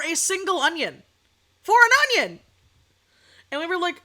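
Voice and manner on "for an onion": 1 outraged exclaim. Real, not acted. Outraged about onion price.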